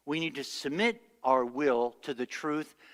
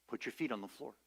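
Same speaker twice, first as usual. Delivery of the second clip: much softer, gentle command; soft voice of God; 3 semitones lower, 12 dB quieter, faster than usual. Retold or imitated. God's voice gently repeating to the bedridden woman, soft. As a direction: hush it down like a voice from above.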